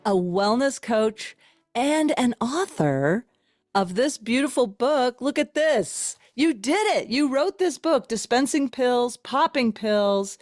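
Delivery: slow, upbeat host introduction; announcer. Acted. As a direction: introduce the guest like a showman. Hosts the guest introduction.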